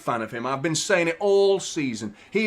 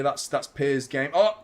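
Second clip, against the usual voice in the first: ordinary talk, nothing marked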